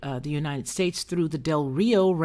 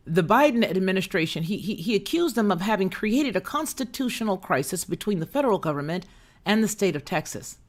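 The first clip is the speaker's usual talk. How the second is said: normal talking voice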